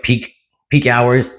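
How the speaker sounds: ordinary talk, nothing marked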